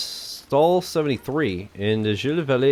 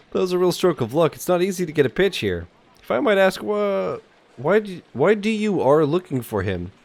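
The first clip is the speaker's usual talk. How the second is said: higher-pitched character voice for the bookseller; old French bookseller; 6 semitones higher than usual. Acted. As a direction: play the bookseller politely asking questions.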